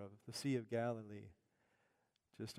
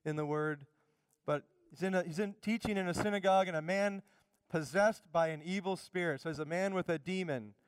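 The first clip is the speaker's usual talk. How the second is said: usual speaking voice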